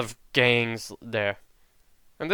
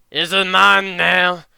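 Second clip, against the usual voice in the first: taunting; 4 semitones higher, 7 dB louder than usual. Acted. Boar fighter taunts over taken watch.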